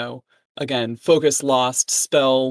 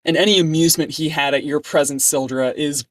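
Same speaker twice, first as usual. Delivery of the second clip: ordinary delivery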